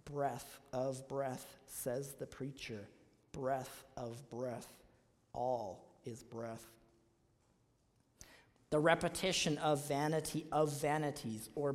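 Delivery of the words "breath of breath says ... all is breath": slow, soft reading of Breath of breath, stretched out; slow literal reading. Shown as a demonstration. Preacher reading the literal translation of verse two, slow and hushed. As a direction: let each breath hang, softly and very slowly. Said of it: "Verse two would literally be read".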